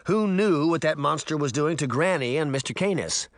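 usual speaking voice

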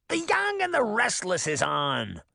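hissed through a fed-up witch's voice; an old witch, irritated at being interrupted; seething witch. A narrator's reading. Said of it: "Baba Yaga seethed".

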